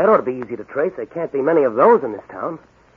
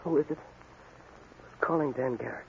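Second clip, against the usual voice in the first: faint, slow, barely there; a wounded man stirring from sleep, drawn out; weak murmur; 15 dB quieter, much slower than usual. Acted. Wounded policeman answers a voice at his bedside, weak and slow. Weak patient wakes in hospital bed.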